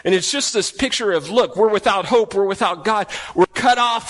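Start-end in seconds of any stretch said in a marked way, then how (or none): none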